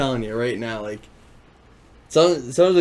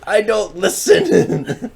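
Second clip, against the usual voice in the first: higher, louder, slow laughing voice; mock confession; 10 semitones higher, 8 dB louder, much slower than usual. Confessing to his girlfriend, slow laughing mock confession. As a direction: admit it slowly with a laugh. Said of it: "I'm like".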